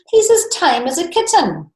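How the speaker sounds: put-on high, pleading young boy; boy's voice. A narrator's reading. Boy begging the king to spare his dragon, high and pleading.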